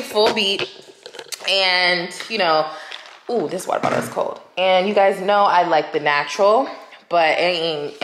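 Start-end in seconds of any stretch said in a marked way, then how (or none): none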